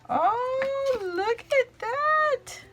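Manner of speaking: delighted squeal. Real, not acted. Showing the gift to viewers, slow squealing delight. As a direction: melt over it, high and slow. Finding vellum in the envelope.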